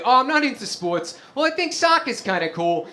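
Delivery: put-on high-pitched, meek young self; lightly mocked unsporty boy voice; timid kid. Younger self answering the neighborhood kids, high timid voice. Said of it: "And I'd be like".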